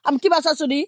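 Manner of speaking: normal talking voice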